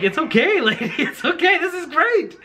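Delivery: high, giggling reassurance; laughing comfort. Retold or imitated. Husband mimicking himself comforting his crying wife, high and laughing. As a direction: laugh while patting her back. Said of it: "And I'm just like".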